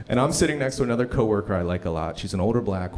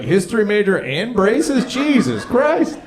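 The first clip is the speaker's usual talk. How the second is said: mock heckler; 10 semitones higher, 7 dB louder, slower than usual. Audience member mocked.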